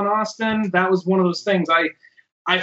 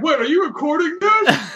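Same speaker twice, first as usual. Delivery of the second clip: mock alarm; 8 semitones higher than usual. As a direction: yelp it on realizing it was recorded. Realizing the call was recorded.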